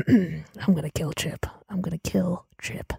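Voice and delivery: hushed murderous whisper; hissed vow under her breath; whispered threat. Acted. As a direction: whisper it through clenched teeth. Bride muttering threat.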